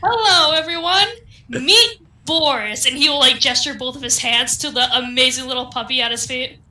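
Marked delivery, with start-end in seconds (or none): 0.0-2.8 calling out to the party; loud announcement. Acted. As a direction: present the dog to the room. Cleric announces dog to party.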